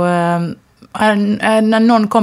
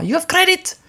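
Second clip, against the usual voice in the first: much higher, mock Chinese-accented English; imitating a Chinese accent; 13 semitones higher than usual. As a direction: impersonate the Chinese landlady's accent, pitched way up. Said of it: "en kinesisk tjej som svarade med en brytning".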